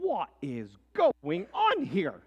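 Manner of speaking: a general scolding men who break ranks; outraged general. Retold or imitated. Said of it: "General Braddock rides up and says".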